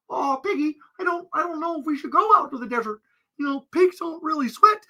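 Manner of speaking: high, fast nervous frog; Kermit-style frog voice. Pretend. Frog warning Piggy about the desert, high fretting. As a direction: fret like a cartoon frog.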